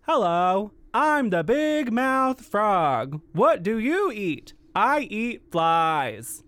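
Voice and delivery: loud, slow, wide-mouthed frog voice; big mouth frog. Acted. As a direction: boom each word through a huge mouth.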